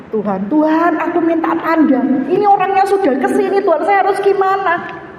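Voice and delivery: high, urgent pleading; desperate prayer. Retold or imitated. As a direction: re-enact begging God for direction. Retold desperate prayer to God.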